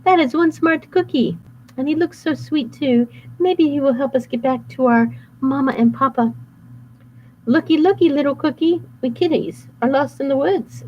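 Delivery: high put-on Gretel voice; overly sweet, playing innocent; fake sweet girl. Wolf disguised as Gretel luring cookie, sweet.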